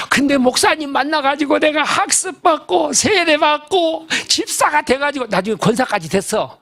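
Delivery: weep it out, begging the pastor; crying plea. Retold or imitated. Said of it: "그러면서 막 우는 거야". (울먹이며 높고 느리게 애원하는 목소리)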